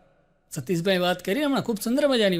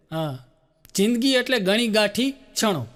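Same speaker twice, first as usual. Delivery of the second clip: usual speaking voice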